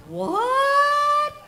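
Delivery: high, loud shocked shout; stunned outcry of what. Real, not acted. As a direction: jolt out the word in disbelief. Shock at news of accident.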